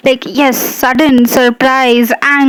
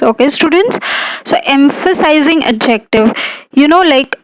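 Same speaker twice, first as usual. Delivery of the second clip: ordinary delivery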